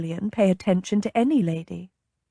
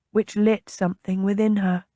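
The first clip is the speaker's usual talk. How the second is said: ordinary delivery, nothing marked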